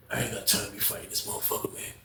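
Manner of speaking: usual speaking voice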